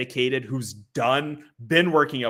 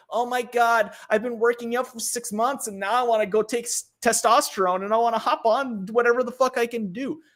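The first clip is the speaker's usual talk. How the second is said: excited gym newbie impression; 10 semitones higher than usual. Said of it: "the person who's like".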